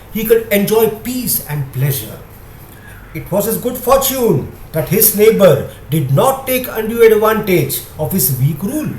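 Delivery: plain reading voice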